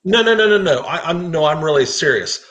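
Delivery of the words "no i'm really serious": usual speaking voice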